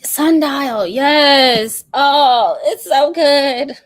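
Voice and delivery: loud, high, slow so good; gushing. Real, not acted. Praising Sundial to viewers, loud gushing. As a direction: swoon over the book.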